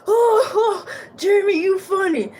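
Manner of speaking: put-on high, loud, delighted Russian accent; thick Russian accent. A narrator's reading. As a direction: crow with amusement in a Russian accent. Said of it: "in his thick Russian accent".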